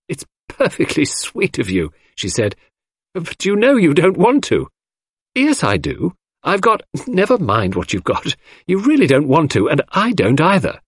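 a hint of laughing girl turning him down; amused Cynthia. A narrator's reading.

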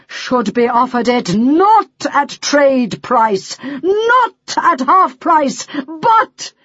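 slow, building to a high, loud peak. A narrator's reading. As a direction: stretch it out, rising to the climax. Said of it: "his voice growing louder and louder".

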